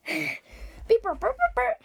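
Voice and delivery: high beeping and burping noises, playful; machine noises. Pretend. Kid making broken time machine noises. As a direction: mimic a sputtering machine, rising.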